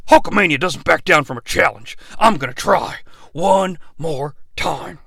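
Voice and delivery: usual speaking voice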